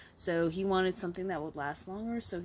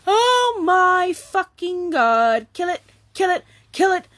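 screaming at a roach; 16 dB louder than usual. Real, not acted. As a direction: scream in real terror at a bug. Bug found during recording.

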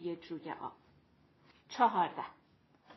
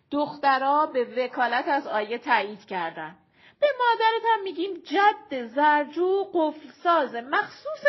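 loud and lively, pitched up; playful, animated friend; 4 semitones higher, 7 dB louder than usual. Acted. Shirin reporting her prank to Arezu, playful and animated. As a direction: spin the tall tale loudly with relish.

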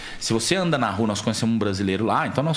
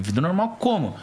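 higher-pitched retold disbelief; incredulous question; 8 semitones higher than usual. Visitor to a local about life at minus thirty, retold incredulous question. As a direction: question it as if it made no sense. Questions the man's casual answer.

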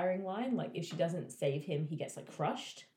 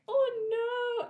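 mock anguish; 6 dB louder than usual. Tamlin's anguished cry retold.

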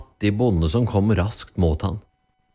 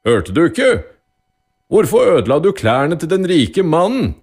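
loud, raised-pitch repeated question; insistent judge; 8 semitones higher, 6 dB louder than usual. A narrator's reading. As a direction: demand an answer forcefully from the silent accused. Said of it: "gjentok dommeren".

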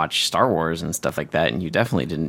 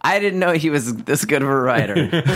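Walt's dad; 6 semitones higher than usual. Retold or imitated. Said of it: "Walt's dad".

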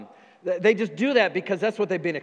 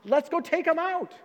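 mock rallying cry; 6 semitones higher than usual. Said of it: "what they've grown up with".

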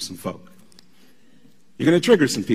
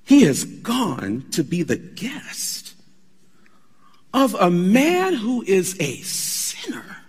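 grumbling crowd; 6 semitones higher, slower than usual. Retold or imitated. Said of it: "began to grumble".

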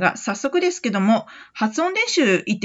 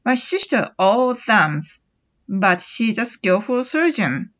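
very slow, careful model reading; slow example sentence; much slower than usual. Shown as a demonstration. Teacher reads the example slowly for students. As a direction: spell it out slowly for learners. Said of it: "ちょっと例を挙げてみます".

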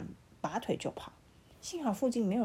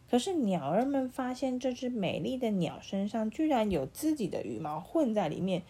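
ordinary delivery, nothing marked

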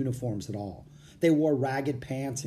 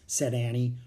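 plain reading voice

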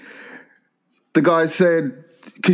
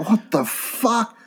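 shocked disbelief; 7 semitones higher, 4 dB quieter, much slower than usual. Retold or imitated. Retold shock at doctor's verdict.

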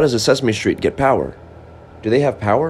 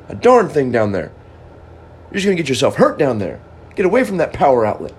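angry scolding; 6 semitones higher than usual. Acted. Angry device scolding Joe on the floor, raised nagging voice. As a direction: scold him away from the outlet like a cross parent. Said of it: "Boy, you got angry at me".

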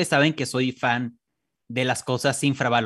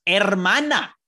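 excited; 7 semitones higher, 4 dB louder, slower than usual. Real, not acted. Excited reaction to comment.

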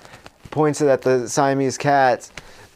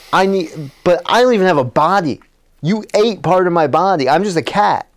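higher, worked-up mock protest; lamenting cat; 4 semitones higher than usual. Billy stuck as a cat, mock lamenting. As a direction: protest your lot, pitched up.

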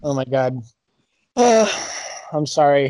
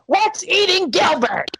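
shouting; 11 semitones higher, slower than usual. Acted. Impression game, shouted movie title.

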